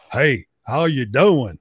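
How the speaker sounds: slow, drawn-out country drawl impression; Jock's country accent. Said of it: "He goes from sounding like Shrek to going".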